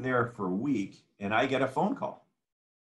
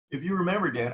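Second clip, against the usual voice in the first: ordinary talk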